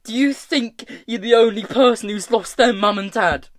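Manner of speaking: high, loud, heated tirade; angry screaming. A narrator's reading. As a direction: rant at a friend in pain and anger. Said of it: "Tom screamed".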